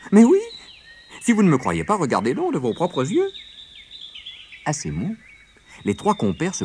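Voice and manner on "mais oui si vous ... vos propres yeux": high, animated storybook voice; insistent rabbit. A narrator's reading. Rabbit urging doubters to look for themselves, high and insistent. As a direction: urge them emphatically, pitched up.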